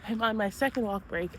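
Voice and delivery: breathless, panting between words; out of breath. Real, not acted. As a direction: talk while catching your breath. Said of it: "I'm out of breath".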